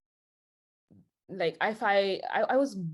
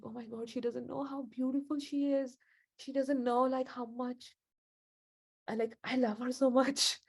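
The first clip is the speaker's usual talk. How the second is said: soft, higher, dreamy gushing over her; awestruck gushing; 5 semitones higher, 3 dB quieter than usual. Retold or imitated. Telling how she fell for her, softly awestruck. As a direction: breathe it out like you're smitten.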